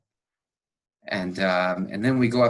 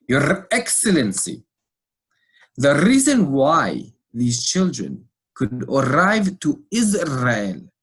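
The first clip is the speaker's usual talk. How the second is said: slow, loud, formal diplomat delivery; each word weighed, ceremonious and raised; political manner; 4 semitones higher, 6 dB louder, much slower than usual. Retold or imitated. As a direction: address the ambassador like a statesman. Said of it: "He speaks in a much more, um, political manner".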